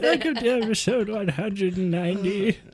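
put-on high, shouting old man. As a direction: croak out the intro loudly.

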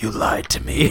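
breathless whispered "you lied to me"; wounded whisper. Retold or imitated. Voicing stabbed stick figure.

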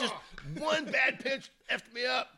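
high, slow, put-on pitcher voice; frustrated pitcher. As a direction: whine it like a rattled pitcher. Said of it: "They were like".

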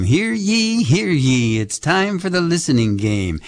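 high town crier proclamation, hear ye; mock royal decree. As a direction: call it out like a herald. Kids radio host opens game.

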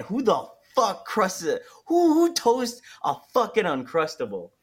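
mock outrage in your head; incredulous inner voice. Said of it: "in my head".

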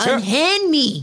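slow, high, melodramatic damsel protest; hammy stage actor. Pretend. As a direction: overact it like a period drama heroine. Mock old-fashioned damsel protest.

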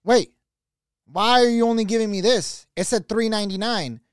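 imitated affronted lady; indignant customer. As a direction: challenge the cashier over the change. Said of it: "The lady goes".

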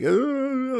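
put-on high drunken-sounding wail; imitated ugly cry, like a drunk; mock ugly-cry wail. Showing how the ugly cry sounds, mock drunken wail. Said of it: "like a drunk".

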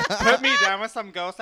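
ordinary delivery

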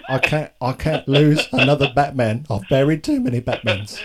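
hammed-up English butler voice; mock English accent. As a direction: play a weary English butler mourning Batmen. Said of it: "when I do accents".

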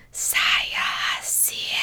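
unvoiced snake hiss; soft hissed snake speech; Parseltongue hiss. Shown as a demonstration. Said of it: "when I say Parseltongue".

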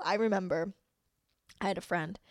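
ordinary talk, nothing marked

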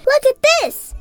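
high, loud call; excited yell. Acted. Betsy calling her friends to her snow angel, loud yell. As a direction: call everyone over to look. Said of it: "Betsy yelled to everyone".

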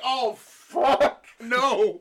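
loud, high cry of dismay; big hammed-up howl of dismay; upset outburst. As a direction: re-enact a dismayed shout. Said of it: "I was so upset".

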